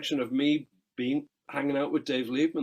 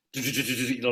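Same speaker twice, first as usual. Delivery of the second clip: rapid pencil taps. Retold or imitated. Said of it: "And he went".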